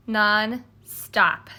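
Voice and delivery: plain reading voice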